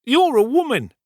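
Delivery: high, loud indignant comeback; plucky retort. A narrator's reading. Brittles answering the housemaid, plucky high retort. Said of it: "retorted Brittles, plucking up a little".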